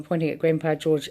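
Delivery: plain reading voice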